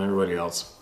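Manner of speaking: ordinary talk, nothing marked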